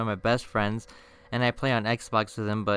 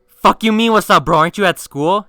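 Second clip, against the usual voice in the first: high, loud acted disbelief; incredulous outburst; 8 semitones higher, 11 dB louder than usual. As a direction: yell it at a friend who is far too casual. Acted disbelief at friend's casual text.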